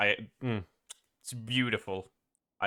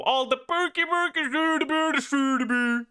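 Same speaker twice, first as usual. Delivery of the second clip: much higher sing-song Swedish gibberish; funny mock-Swedish accent; 12 semitones higher than usual. Swede giving permission to mock, high silly Swedish accent. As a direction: trill nonsense in a cartoon Swedish accent. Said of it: "make a funny accent".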